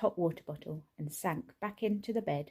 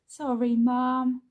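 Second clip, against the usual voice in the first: louder, higher, slow called-out sorry; yelled apology; 6 semitones higher, 8 dB louder, slower than usual. A narrator's reading. Laura rushing in for the baby, yelled apology. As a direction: call it across the room. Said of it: "yelled Laura".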